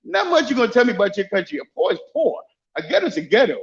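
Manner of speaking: ordinary talk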